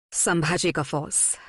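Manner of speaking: plain reading voice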